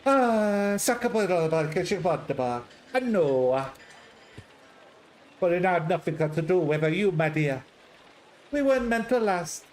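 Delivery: put-on theatrical sigh into an Italian accent. Patrizia consoling the visitor, theatrical Italian accent. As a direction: sigh grandly, then console her in Italian lilt.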